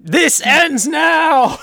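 very slow, high, loud movie-hero vow; a hero swearing to end it all; dramatic vow. Pretend.

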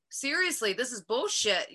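irritated scoff; pissed off. Retold or imitated. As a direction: let it out, fed up with waiting. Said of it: "I was pissed".

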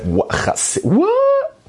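mock-shocked sputter, leaping to a very high squeal; stammer, then squeak out the shock; stunned disbelief. Man retelling a friend's shock at surprising news, astonished squeal.

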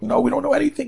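high-pitched, helpless protest; panicked reply. Acted. Panicked passengers answering the emergency flight operator, high-pitched helpless protest. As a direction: voice leaps up in alarm.

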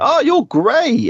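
high, slow mock-sweet gush; mock the sudden sweetness; gushing spoiled girl. Stepdaughter perking up at money, high slow mock-sweet gush. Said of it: "she's like".

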